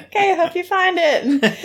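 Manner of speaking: high-pitched mock-polite unease; uncomfortable politeness. Imitating the old lady humouring the crouched man, uneasily polite. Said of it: "she's not comfortable with him".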